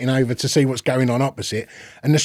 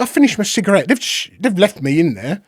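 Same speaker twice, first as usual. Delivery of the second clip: normal talking voice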